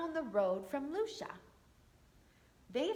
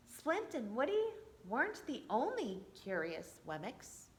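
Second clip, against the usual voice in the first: plain reading voice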